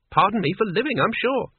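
high, huffy and offended. A narrator's reading. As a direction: put on a wounded sniff. Huffy old wizard retort.

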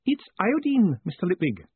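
high tearful answer, falling away; snuffling. Acted. Clerk giving Moist a first name, high and snuffly. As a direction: answer high, as if through a handkerchief. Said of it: "snuffling into a handkerchief".

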